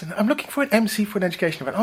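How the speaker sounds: high, quick posh Southern English lady. Retold or imitated. Posh Southern English lady booking an MC, fast and high. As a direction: rattle it off in a plummy Southern English voice. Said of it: "Really posh voice".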